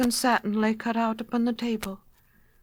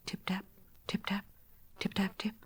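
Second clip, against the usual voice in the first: hushed, tiny tapping sounds; soft little tapping; 9 dB quieter than usual. A narrator's reading. Tiny noises under the teacup, hushed tapping. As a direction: tap the words out softly like mice. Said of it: "a chorus of little tappings".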